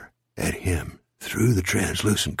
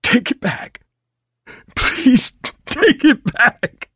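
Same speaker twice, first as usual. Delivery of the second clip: high, tearful begging; desperate sobbed plea, growing louder; crying; 13 semitones higher, 5 dB louder, slower than usual. Acted. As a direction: plead through sobs, pitch soaring. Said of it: "He began to cry".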